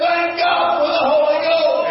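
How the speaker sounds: chanted out as a preacher's shout; shouted repeat. Shown as a demonstration. Preacher repeating his praise to the congregation, shouting. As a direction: hit it again, shouting it to the back pew. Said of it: "I said".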